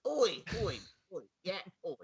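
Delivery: put-on Cockney accent. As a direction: holler like a cartoon Cockney, then fade. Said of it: "give me a little Cockney accent there".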